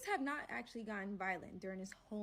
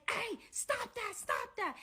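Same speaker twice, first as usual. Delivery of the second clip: raised-pitch retold order to stop; urgent command; 11 semitones higher, slower than usual. Man breaks up the fight.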